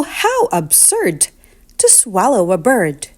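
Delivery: ordinary delivery, nothing marked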